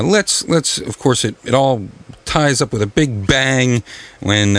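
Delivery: normal talking voice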